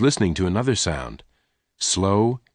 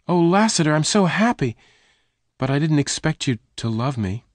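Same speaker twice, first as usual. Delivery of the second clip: much higher, joyful; overjoyed Jane; 8 semitones higher than usual. Acted. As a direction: burst with happiness.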